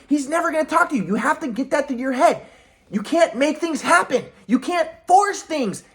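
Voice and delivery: high-pitched, insistent imitation of a lecturing parent; scolding parent. Parent telling son to stop hoping for Dad, mock-scolding high voice.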